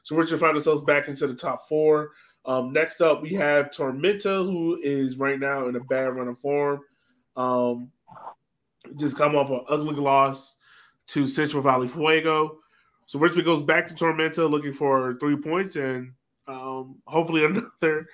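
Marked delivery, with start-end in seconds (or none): none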